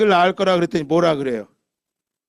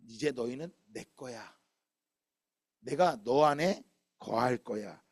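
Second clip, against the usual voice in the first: tender God voice; 6 semitones lower, 10 dB quieter than usual. Acted. God's tender promise to family.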